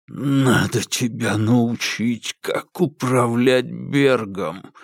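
slow hoarse rasp through clenched teeth; wheezed through teeth. A narrator's reading. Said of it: "сжав зубы, прохрипел Алик".

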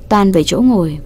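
plain reading voice

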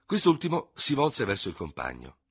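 ordinary delivery

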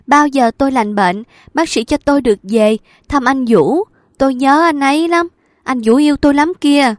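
high, loud pleading for her lover; feverish pleading. A narrator's reading. Sick woman begging the doctor to visit her lover, high and loud. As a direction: beg the doctor with childlike longing.

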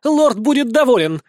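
high, joyful crowing cry; gleeful holler. A narrator's reading. Enemy gloating that the lord will be pleased, gleeful holler. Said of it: "Горланит ещё радостно".

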